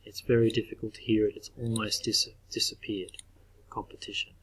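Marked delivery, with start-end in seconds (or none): 3.7-4.3 whispered Competition, very soft; soft whispered repeat. Shown as a demonstration. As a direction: breathe Competition to yourself. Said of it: "whispered aside".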